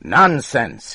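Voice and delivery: loud, high dismissive shout; angry shout. Acted. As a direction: fling the word back at him. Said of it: "he shouted".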